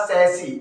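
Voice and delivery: सैसी said "SAS-ee", silent final s; correct pronunciation. Shown as a demonstration. Teacher giving students the correct word, pronounced "sas-ee". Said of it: "इसका correct pronunciation".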